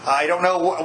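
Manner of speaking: ordinary talk, nothing marked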